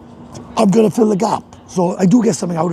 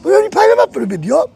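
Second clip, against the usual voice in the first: fast, much higher, strained shouting; angry shout; 11 semitones higher, faster than usual. Retold or imitated. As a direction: fire it back fast, close to tears with rage. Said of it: "Tom said".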